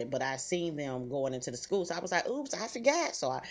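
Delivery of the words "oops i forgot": higher-pitched mock oops; acting out a sudden remembering; sudden realisation. Mother retelling remembering the school, sudden realisation.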